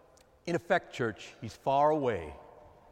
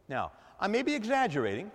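ordinary talk, nothing marked

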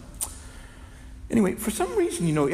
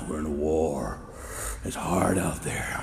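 weary grim murmur; 8 semitones lower, 4 dB quieter, slower than usual. Retold or imitated. Grim soldiering-on voice.

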